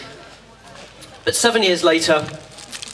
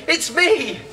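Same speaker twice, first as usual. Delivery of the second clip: much higher, triumphant burst with a laugh; spring the surprise like a delighted prankster; hammed-up cheery ta-da; gleeful reveal; 10 semitones higher than usual. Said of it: "and he says".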